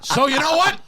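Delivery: Pacino rant. Acted. Pacino rant bit continues.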